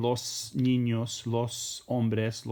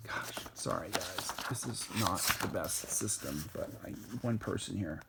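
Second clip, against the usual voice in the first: softer apologetic aside to the class; quiet aside; 6 semitones lower, 10 dB quieter than usual. Real, not acted. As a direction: mutter an apology off to the side. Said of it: "Sorry, it's hard to do this".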